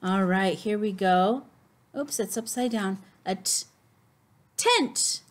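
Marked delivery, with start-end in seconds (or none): 4.6-5.2 excited naming. Acted. Teacher names picture excitedly.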